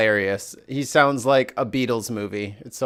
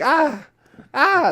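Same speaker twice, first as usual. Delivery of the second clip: dog yelp imitation; 13 semitones higher, 4 dB louder than usual. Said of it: "he's like".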